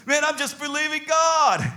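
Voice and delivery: acted exasperated exclamation; protesting response. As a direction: cry out in self-defence. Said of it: "David's response to him was".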